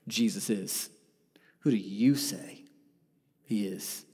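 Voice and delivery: soft whispered challenge; intimate whisper. Real, not acted. Preacher asks each listener who Jesus is, whispered. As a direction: lean in and whisper the question. Asking the congregation personally.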